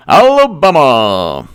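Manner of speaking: loud, drawn-out high call; exclaimed shout. Acted. Commentator calling out Biden's state win, drawn-out holler. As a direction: holler it slowly, stretching the word. Calls out the state just won.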